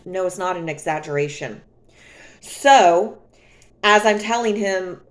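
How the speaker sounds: ordinary delivery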